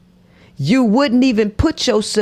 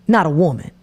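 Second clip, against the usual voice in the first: usual speaking voice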